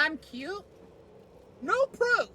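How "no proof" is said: NO shouted high; loud playful taunt. Pretend. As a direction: bark the NO out. Taunt repeated.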